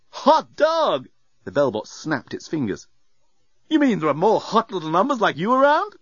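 high, cheeky bellbot voice; leering service robot; flirty robot voice. A narrator's reading. Bellbot character voice.

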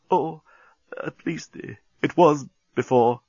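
faltering, halting sadness; voice cracking. A narrator's reading. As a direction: break off haltingly as grief intrudes. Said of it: "Bolfas's voice seemed to crack".

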